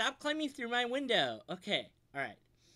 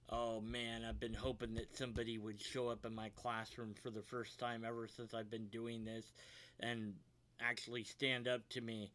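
sinister teacher voice; 9 semitones lower, 10 dB quieter than usual. Acted. Teacher villain delighted, low.

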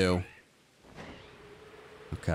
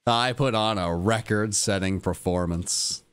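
ordinary talk, nothing marked

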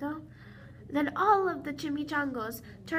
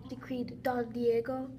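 plain reading voice

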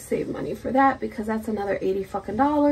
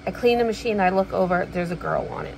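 ordinary delivery

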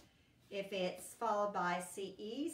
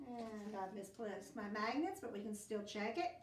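ordinary delivery

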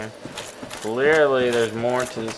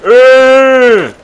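loud, high frustrated shouts; fed-up, loud outburst; exasperated yelling; 9 semitones higher, 12 dB louder, much slower than usual. Real, not acted. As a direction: yell at an enemy that won't die. Yelling at enemy that heals.

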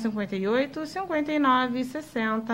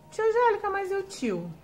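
pupil's question; 8 semitones higher than usual. Pretend.

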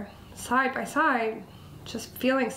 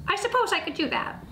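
high, quick little mouse voice; tiny pitched-up critter; squeaky mouse; 8 semitones higher, faster than usual. A narrator's reading. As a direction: squeak out a willing agreement. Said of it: "squeaked Mouse".

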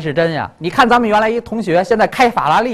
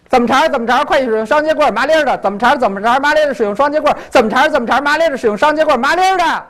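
higher-pitched Beijing patter with rolled endings; Beijing dialect; 5 semitones higher, much faster than usual. Shown as a demonstration. Showing a child the song in Beijing dialect, Beijing accent. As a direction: rattle it off in a thick Beijing accent. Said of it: "北京话怎么说呀？".

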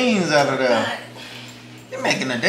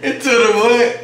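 mock disbelief; 6 semitones higher, 4 dB louder than usual. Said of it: "Stop doing that".

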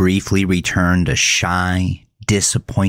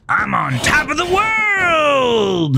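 very high, slow cried shout; manic shout; slower than usual. A narrator's reading. Melvin on the car roof shouts to the whole lot, very high cry. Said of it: "Melvin shouted".

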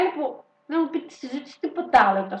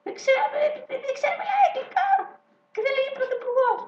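raised mock voice, half laughing; mock high voice. Mocking the young prime minister in a high voice. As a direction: squeak it out like a flustered politician.

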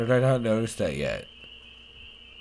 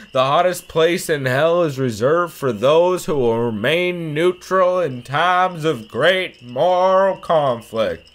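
loud, raised southern orator voice; Martin Luther King Junior; 6 semitones higher, 8 dB louder, slower than usual. Acted. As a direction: declaim it like a speech, slowing at the end. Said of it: "You like my M L K voice?".

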